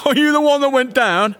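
high, loud hail. A narrator's reading. Farmerson greets the fallen dancer, in a loud voice. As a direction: call it out for the whole room. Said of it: "in his loud voice".

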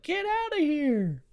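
high, slow, drawn-out imitation yell; mock yelling. Homeowner to the man photographing his house, mock yelling. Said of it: "this guy's just yelling at him".

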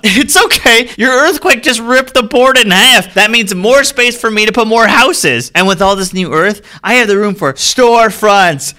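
gleeful. Acted. Gleeful developer after earthquake.

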